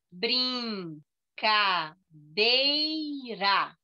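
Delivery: "Brincadeira" very slowly split into four; drawn-out beats on each syllable of "Brincadeira". Shown as a demonstration. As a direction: say "Brincadeira" as brin, ca, dei, ra with pauses. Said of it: "quatro sílabas".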